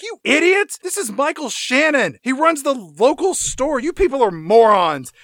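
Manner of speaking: high, loud scolding; exasperated Judy Greer. Retold or imitated. Scolding the gullible townsfolk, loud exasperated rant. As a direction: tear into the crowd in disbelief. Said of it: "and she's like".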